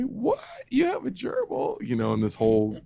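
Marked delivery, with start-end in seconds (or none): 0.0-1.8 much higher, mock shocked mother; surprised mom. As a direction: play the startled mom in a squeaky voice. Mom voiced in retold story.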